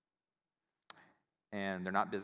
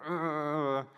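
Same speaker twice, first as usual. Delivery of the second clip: frustrated groan. Retold or imitated. Lying in bed angry.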